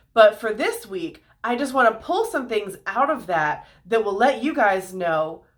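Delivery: normal talking voice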